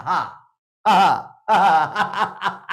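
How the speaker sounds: slow stretched ha ha; long drawn laugh. Shown as a demonstration. Lecturer practising a longer laugh looking up. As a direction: hold each ha long toward the ceiling. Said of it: "좀 더 길게 웃기 연습".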